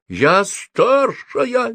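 very high, drawn-out screech. A narrator's reading. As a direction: screech it slowly, voice way up. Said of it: "верещала Софи". (верещать, высоко и протяжно)

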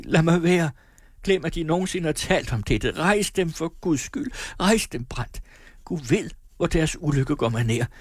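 pleading Sofie. Acted. Sofie begs Brandt to get up, high-pitched pleading.

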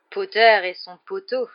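loud, slow, drawn-out mockery; drawling taunt. A narrator's reading. Malfoy mocking Potter for his cronies, drawling loudly. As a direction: stretch it out to needle them. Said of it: "de son habituelle voix traînante".

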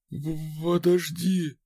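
chattering teeth, shivering. A narrator's reading. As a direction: stammer through chattering teeth. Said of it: "стуча зубами".

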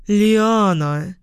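LIANA drawn out, lifting at the end; mildly scandalised "Лиана" with a rising tail; shocked scolding. A narrator's reading. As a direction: cry "Лиана" in mild reproach, ending on a rise. Mother scolds her joking daughter.

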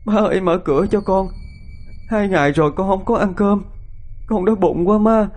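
high tearful pleading through sobs; crying, begging. A narrator's reading. Starving wife begs her mother-in-law to open the door, sobbing. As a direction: beg desperately at the door, crying. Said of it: "mà khóc lóc van xin".

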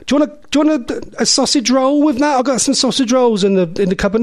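high-pitched, fussing nan voice; doting nanny. Retold or imitated. Nanny pushing sausage rolls on grandchild, high eager voice. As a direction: press food on them like an eager grandmother.

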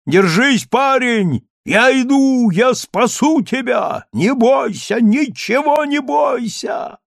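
high, loud, slowed raging shouts; frenzied yelling. A narrator's reading. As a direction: roar defiance then call to the drowning lad. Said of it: "кричал он неистовым голосом".

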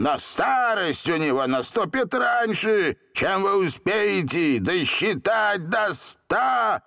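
high, swelling genie pronouncement; triumphant decree. A narrator's reading. Genie cursing Seryozha with instant old age, triumphant. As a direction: stretch out the final words of the curse.

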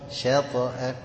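شطأه with a careful sh; applying the letter qualities. Shown as a demonstration. Teacher correcting a student's شطأه, careful demo. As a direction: say شطأه slowly, sh kept light. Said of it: "ما احنا عايزين نطبق الصفات".

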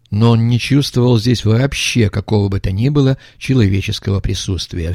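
ordinary delivery, nothing marked

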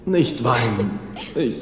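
low, soft, broken voice; grieving father. Acted. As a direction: comfort her while your own heart breaks. Father realizes he is dead.

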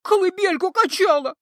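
loud, high tearful pleading; sobbing desperate plea; crying. A narrator's reading. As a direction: wail through tears. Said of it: "плакала Кикимора".

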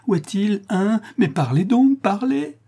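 high, pressing plea to speak; desperate demand. A narrator's reading. La Louve begs the fisherman for news, frantic. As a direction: beg him frantically to talk.

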